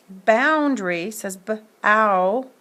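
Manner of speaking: boundary slowed right down, then b-ow split apart; sounding out. Shown as a demonstration. Teacher isolating the vowel sound for the class. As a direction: break boundary down to b-ow, slowly. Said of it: "the sound says ow".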